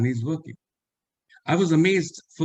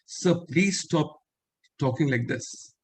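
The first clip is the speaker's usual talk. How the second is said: stern rebuke; 3 semitones higher, slower than usual. Retold or imitated. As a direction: slowly put him firmly in his place. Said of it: "in a very stern word and tone told".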